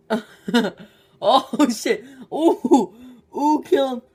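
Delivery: high, loud laughing squeals; laughing loudly. Real, not acted. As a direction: squeal through a laughing fit. Tissue in nose, laughing fit.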